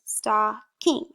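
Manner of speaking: "Stocking" in two separated beats; each syllable of "Stocking" on its own; counted-out syllables. Shown as a demonstration. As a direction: split "Stocking" into stock, king. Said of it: "do that with me with your fingers".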